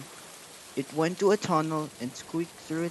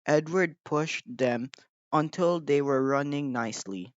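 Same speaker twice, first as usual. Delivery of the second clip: plain reading voice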